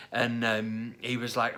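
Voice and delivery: usual speaking voice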